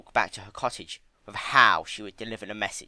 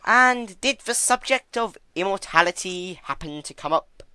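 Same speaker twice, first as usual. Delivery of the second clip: theatrical louder trickster voice; Discord, grandly; 10 semitones higher, 7 dB louder than usual. A narrator's reading.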